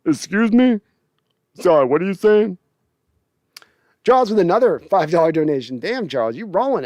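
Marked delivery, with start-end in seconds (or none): 0.0-2.6 indignant lineman. Retold or imitated. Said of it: "They'd be like".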